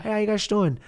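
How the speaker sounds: high-pitched mock Icelandic accent. Icelandic kid saying hello to the Americans, mock Icelandic accent. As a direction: put on a chirpy Icelandic greeting. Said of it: "They talk like this".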